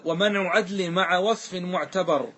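slow, measured chanting of a verse; a teacher intoning a grammar verse; verse recitation. Acted. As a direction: recite the line of the poem deliberately. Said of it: "ثم قال".

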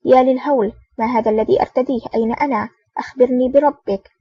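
plain reading voice

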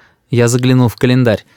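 ordinary delivery

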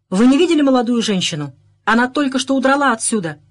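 moderate shout. A narrator's reading. Inspector calling to constables below the window, moderate shout. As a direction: call down to someone outside. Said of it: "крикнул Кеннеди".

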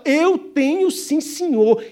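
insist, drawing it out; firm loud reply. Acted. Englishman answering the auctioneer, firm and loud.

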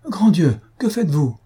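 sudden high distressed cry of surprise. A narrator's reading. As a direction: gasp it out in alarm, frozen in shock. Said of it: "avec un accent de détresse".

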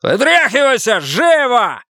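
high, furious shouted order; sudden yell. A narrator's reading. Husband suddenly ordering wife out of the car, yelling. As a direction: bellow it without warning. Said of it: "вдруг заорал он".

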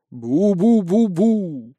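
very slow, wavering "bu-bu"; long eerie moan cutting the silence; strange ghostly sound. A narrator's reading. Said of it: "Странный звук разрезал тишину". (протяжный странный вой)

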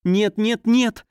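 high frightened cry of no; крик. Acted. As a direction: cry out in sudden panic, pitch jumping up. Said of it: "вскричала я". (испуганный высокий вскрик)

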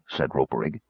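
usual speaking voice

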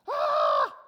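very loud hammed-up scream; mock little-girl scream. Exaggerated girlish scream. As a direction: comical shriek of terror. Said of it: "they're screaming like little girls".

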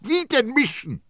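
high, loud incredulous outburst; loud cry. A narrator's reading. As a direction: shout it in his face. Baldini mocking.

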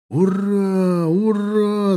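high, loud, long-held cheer; battle roar. A narrator's reading. Troops roaring on the hills round the fortress, battle cry. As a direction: hold each cry like charging troops. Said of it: "донёсся рёв на окружающих крепость холмах".

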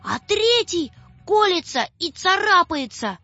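put-on high, breathless scared girl; a frightened child telling her father; frightened calling. A narrator's reading. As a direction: blurt it all to papa in fright. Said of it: "кричит".

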